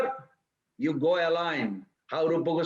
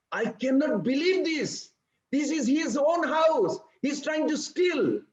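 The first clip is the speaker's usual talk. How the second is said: higher, astonished, slowing on own house; a neighbour who can't believe what she sees; astonished gossip; 7 semitones higher than usual. Retold or imitated. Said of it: "Mother Mukhara is telling to Jashoda Maya".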